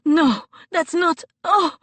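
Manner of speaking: high, loud gasping protest; shocked cry. Acted. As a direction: cry out in horror, cut short. Anya gasps in shock.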